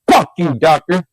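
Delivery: sudden loud, high-pitched shout; screamed outburst. A narrator's reading. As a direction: yell it out like a boy screaming at someone. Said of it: "He screamed out".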